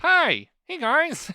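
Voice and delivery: put-on high, loud kid voice; thirteen-year-old [name]. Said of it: "I'm thirteen-year-old [name]".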